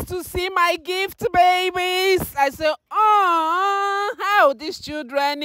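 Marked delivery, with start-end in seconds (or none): 2.9-5.4 higher, choked-up exclaim; tearful exclaim. Retold or imitated. Mother retelling how moved she was by her children. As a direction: retell the moment almost crying. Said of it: "I was so emotional".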